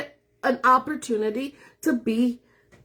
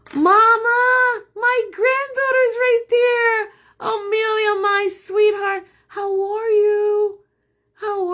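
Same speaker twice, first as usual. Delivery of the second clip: cooing at granddaughter; 10 semitones higher, 5 dB louder, slower than usual. Real, not acted. Grandma greets her granddaughter watching, high sweet coo. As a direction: coo sweetly to a little one.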